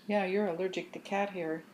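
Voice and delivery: plain reading voice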